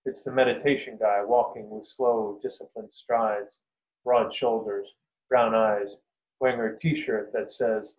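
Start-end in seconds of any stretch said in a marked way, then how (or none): none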